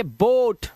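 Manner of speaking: बोट with one long "o"; first-vowel pronunciation. Shown as a demonstration. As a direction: model बोट as a single syllable, "boht". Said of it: "पहले वाले vowel का जो sound".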